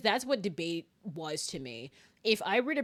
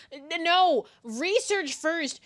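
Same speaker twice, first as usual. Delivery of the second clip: high, loud, stammered objection; playful high-pitched telling-off; emphatic scolding; 9 semitones higher, 7 dB louder, much slower than usual. Real, not acted. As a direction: protest loudly, then slow down to lecture.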